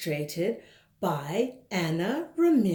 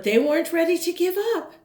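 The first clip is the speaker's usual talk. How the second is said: excited; 8 semitones higher, 5 dB louder, much faster than usual. A narrator's reading. Narrator builds excitement.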